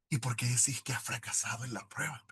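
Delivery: breathy hushed whisper. Retold or imitated. Wife questioning him softly, whispered. Wife answers his despair.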